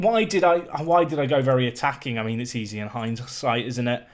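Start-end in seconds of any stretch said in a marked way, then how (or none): none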